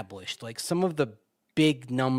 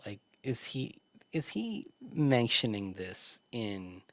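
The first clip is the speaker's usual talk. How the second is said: usual speaking voice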